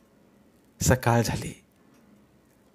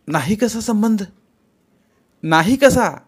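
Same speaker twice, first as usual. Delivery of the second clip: higher pitched, insistent; heated retort; 9 semitones higher, 5 dB louder, slower than usual. Acted. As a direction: fire the questions back at him. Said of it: "त्वेषाने बोलू लागली".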